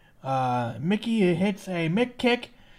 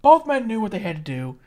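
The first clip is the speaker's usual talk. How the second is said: ordinary talk, nothing marked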